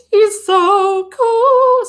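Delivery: high, loud and very slow swoon; gushing. Real, not acted. Streamer gushing over the lawyer's voice, swooning.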